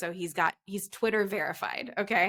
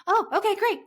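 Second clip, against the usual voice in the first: frantic; 12 semitones higher, 6 dB louder than usual. Retold or imitated. Told wrong terminal.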